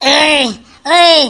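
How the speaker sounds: sharp loud call; angry yell. Acted. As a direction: shout to stop him. Wife yelling at drunk husband. (गुस्से में चिल्लाना)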